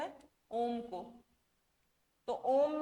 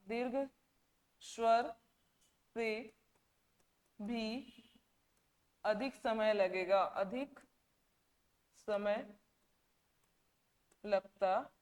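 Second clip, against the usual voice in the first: दीर्घ स्वर से भी अधिक समय spaced with long pauses and softer; slow dictation pace; word by word, slowly; 2 semitones lower, 4 dB quieter, slower than usual. Shown as a demonstration. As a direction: drop the voice and pause after each word.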